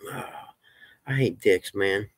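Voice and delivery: ordinary talk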